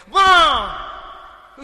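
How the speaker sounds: long high loud wail of "माँ"; drawn-out shrieking "माँ!"; child's scream. Acted. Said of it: "जोर से चिकार".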